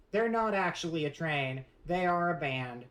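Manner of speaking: normal talking voice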